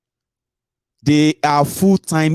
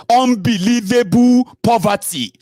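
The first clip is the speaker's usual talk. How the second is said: normal talking voice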